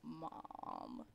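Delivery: "Mom" drawn out softly with a long ah; "Mom" like balm. Shown as a demonstration. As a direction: stretch "Mom" low and soft to rhyme with balm. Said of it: "I feel like that's balm".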